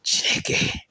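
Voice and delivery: awed whisper of Chineke; hushed, reverent whisper. A narrator's reading. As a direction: breathe it out in a hush. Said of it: "As Judith whispered".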